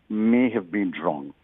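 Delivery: "may" drawn out with firm weight, then falling away; stress on may. Real, not acted. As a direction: lean on "may", let "have been wrong" drop. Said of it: "you're stressing and emphasizing on the word may".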